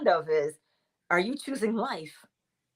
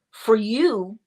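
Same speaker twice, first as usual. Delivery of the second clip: ordinary talk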